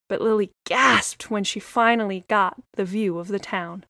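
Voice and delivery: ordinary delivery, nothing marked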